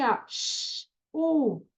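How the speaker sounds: sh hissed unvoiced, then u; isolated sounds. Shown as a demonstration. Teacher to class, sounding out sh and u. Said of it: "que junta assim".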